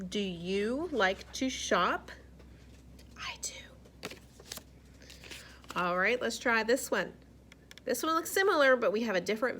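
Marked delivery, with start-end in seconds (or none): 3.2-4.1 whisper. Real, not acted. Playful aside after question.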